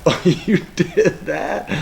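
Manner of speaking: laughing it out high and slow. Retold or imitated. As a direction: chuckle through a mock-surprised question. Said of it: "now I laugh going".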